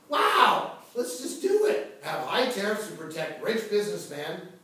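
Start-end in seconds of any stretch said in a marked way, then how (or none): 0.1-2.0 much higher, louder mock cheer; a sarcastic, over-the-top cheer; mock excitement. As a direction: gush with fake enthusiasm. Mock enthusiastic agreement.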